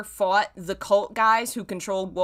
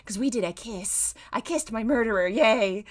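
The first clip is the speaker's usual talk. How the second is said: mock Henry voice; 5 semitones higher than usual. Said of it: "he's like".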